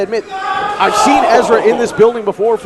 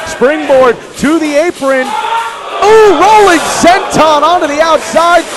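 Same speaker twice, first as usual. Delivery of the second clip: excited play-by-play; 6 semitones higher, 4 dB louder than usual. Real, not acted. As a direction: call the dive to the floor. Calling a high spot.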